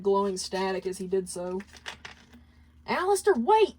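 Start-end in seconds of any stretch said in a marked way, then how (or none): 2.9-3.8 high, urgent call after someone. Acted. Husk calling after his master as he jumps, urgent. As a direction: shout after him as he leaves. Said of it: "Husk then called out after his master".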